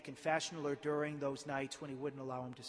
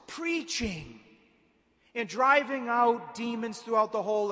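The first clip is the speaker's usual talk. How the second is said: higher, louder pulpit preaching with weighted key words; forceful preaching; 6 semitones higher, 13 dB louder than usual. Real, not acted.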